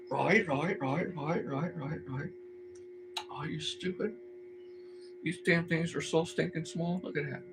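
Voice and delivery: muttering. Real, not acted. Muttering at tiny fiddly parts.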